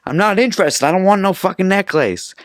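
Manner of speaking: high-pitched retold protest; mock-exasperated, voice shooting up; exasperated refusal. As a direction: push back hard at a pesky vendor. Acting out refusal to pushy vendor.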